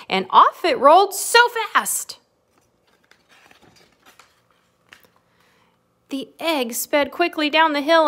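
rising excitement in read-aloud; lively storybook narration; animated story reading. A narrator's reading. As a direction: build up the chase for little listeners. Reads picture book to children.

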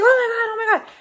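high, loud panicked oh my God; imitating a frantic friend; voiced high and frantic. Friend panicking over the fire.

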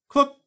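sharp high clucking click; counter click. A narrator's reading. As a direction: imitate a Geiger counter cluck. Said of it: "reported the cosmic ray counter".

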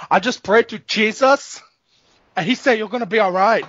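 earnest believer in a mock accent; Vitor impression. Fighter reassures his buddy after praying, mock accent. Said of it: "that's Vitor".

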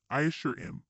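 ordinary delivery, nothing marked

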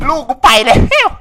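high, loud, slow wail; loud stretched howl; anguished shout. Real, not acted. Child dies in game.

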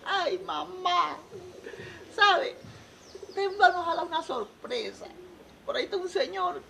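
put-on high, whiny girl explaining to mother; hammed-up high crying voice; mock tearful. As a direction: plead tearfully in comic falsetto. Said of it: "¿Por qué ustedes lloran?".

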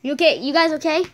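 usual speaking voice

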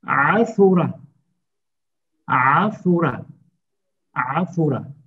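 Asura with a throaty ayn and a round u; Arabic pronunciation. Shown as a demonstration. Teacher drilling Asura for the class, throaty ayn. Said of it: "U sesi çıkarmamız gerekiyor".

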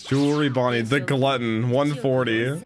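usual speaking voice

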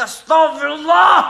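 ordinary talk, nothing marked

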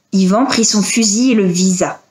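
ordinary delivery, nothing marked

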